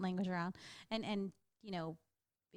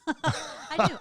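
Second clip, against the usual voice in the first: laughing; 8 semitones higher, 9 dB louder than usual. Real, not acted. Laughing confession about cussing.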